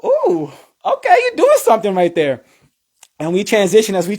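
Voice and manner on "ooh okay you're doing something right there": high, pleased ooh of approval; excited, high-pitched self-commentary; impressed reaction. Retold or imitated. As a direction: cheer yourself on, pitch jumping up. Impressed reaction while watching own routine.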